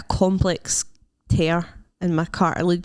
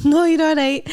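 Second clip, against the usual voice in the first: mock reassuring shopper; 9 semitones higher, 5 dB louder than usual. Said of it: "they were like".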